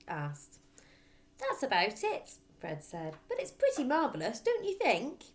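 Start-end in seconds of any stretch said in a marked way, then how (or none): 1.4-5.3 put-on higher, bright boy voice for Fred; Fred's voice. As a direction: play Fred proud of his parrot. Fred voiced in read story.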